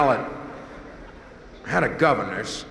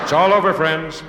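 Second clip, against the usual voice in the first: mock doom; 6 semitones higher, 8 dB louder than usual. Mock doom when light fails.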